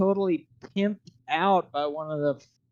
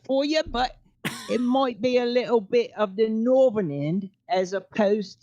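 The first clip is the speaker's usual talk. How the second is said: workshopped put-on British accent; 4 semitones higher than usual. Shown as a demonstration. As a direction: demonstrate a rough British accent. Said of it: "I could workshop a little British accent".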